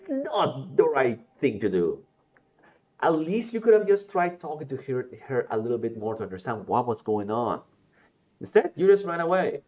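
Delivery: loud, high exasperated telling-off; scolding. Acted. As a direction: chew out a friend who ran away. Voiced character scolding friend.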